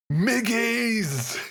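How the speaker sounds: high dopey goblin yell; dopey shout. Retold or imitated. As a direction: shout it like a dim-witted grot. Shouting favourite character's name.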